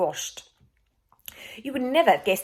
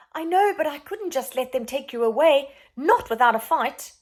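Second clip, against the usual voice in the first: put-on defiant boy; 7 semitones higher, 6 dB louder than usual. Boy defends himself.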